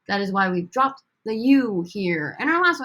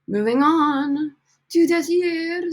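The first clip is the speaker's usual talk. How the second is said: announcer flourish; 7 semitones higher, 7 dB louder than usual. Acted. Announcing next verb.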